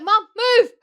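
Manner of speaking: high, loud yell; panicked shout at mum. Retold or imitated. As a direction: scream it at her to move. Rushing mum to the gate.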